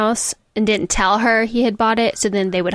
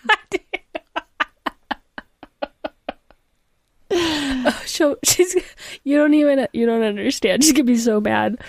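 laughing through tears; 3 semitones higher than usual. Real, not acted. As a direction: laugh crying, voice pitched up. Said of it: "I'm, I'm laugh crying".

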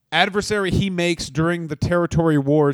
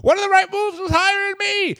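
high character voice; 10 semitones higher than usual. Acted. High put-on announcer voice.

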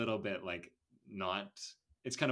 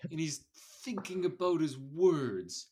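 slow, higher confused drawl; 7 semitones higher, 7 dB louder, slower than usual. Retold or imitated. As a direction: sound lost and confused. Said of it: "He sounds very confused".

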